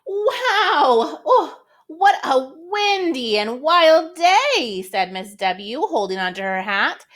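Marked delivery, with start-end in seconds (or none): 0.1-1.2 high, laughing exclamation of surprise; surprised wow. Acted. Miss W caught in the wind, high laughing wow. Windy wild day surprise. 1.3-4.8 high, slow, amazed lady voice; Miss W voice. Acted. Miss W holding her hat in the wind, high slow voice. Reads Miss W's lines.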